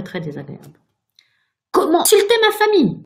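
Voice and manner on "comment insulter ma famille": loud, very high indignant burst; outraged cry. A narrator's reading. Virginia defending her family to the ghost, outraged cry. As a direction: explode in offence. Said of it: "S'écria Virginia".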